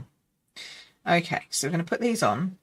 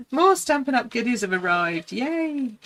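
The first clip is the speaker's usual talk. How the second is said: excited; 6 semitones higher than usual. Real, not acted. Parcel of craft goodies arrives.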